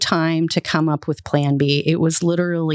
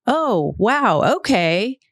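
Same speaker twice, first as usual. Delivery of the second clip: high, casual phone reaction; offhand little acknowledgements; nonchalant; 5 semitones higher, much slower than usual. Retold or imitated. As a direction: take the news in lightly, pitched up. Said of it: "very nonchalantly says".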